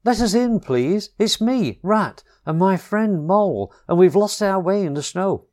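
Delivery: a cold, lost animal calling through the door; cried pleading. A narrator's reading. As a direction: beg to be let in out of the snow. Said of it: "cried the Rat".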